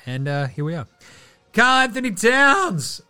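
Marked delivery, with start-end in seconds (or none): 1.5-3.0 high, loud, drawn-out name call; slow, loud, excited name call. Real, not acted. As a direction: announce him like a hype man. Announcing the weekend winner.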